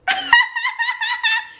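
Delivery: imitated helpless nervous cackle; nervous laugh. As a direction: burst into nervous high laughter. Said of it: "When I'm nervous, I just laugh".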